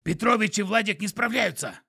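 high, loud creature voice; panicked skunk report; urgent panting report. A narrator's reading. Skunk voice continues.

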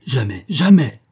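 loud, emphatic cry of refusal; shouted refusal, second word swooping up; outraged cry. A narrator's reading. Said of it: "puis elle a crié".

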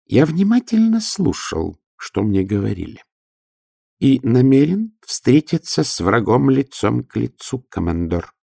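high, firm little clerk; a nervous man standing firm. Acted. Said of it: "твёрдо сказал Аха Пессималь".

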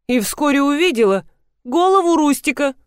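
plain reading voice

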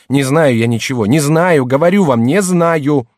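loud high protest building to a cry; high, exasperated outburst; heated denial. Acted. Accused servant hotly denies everything.

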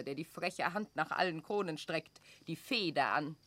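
ordinary talk

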